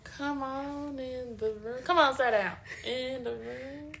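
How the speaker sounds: slow, soft, drawn-out church lady; old church lady. Retold or imitated. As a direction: beckon like an elderly church mother. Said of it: "old lady voice".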